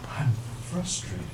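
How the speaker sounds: soft, slow, almost whispered; quiet frustrated statement. Shown as a demonstration. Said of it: "You come home, you're in the kitchen".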